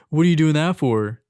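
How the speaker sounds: loud, suspicious demand; mock angry customer. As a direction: bark it like an irritated customer. Acted quarrel with angry customer.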